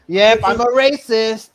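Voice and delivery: slow, loud deadpan fake admission; mock confession. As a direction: say it slow and loud as a sarcastic joke. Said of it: "No, no, but I'm kidding".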